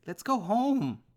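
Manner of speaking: high, loud begging; tearful cry to dad; desperate pleading. Acted. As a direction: plead to just go home.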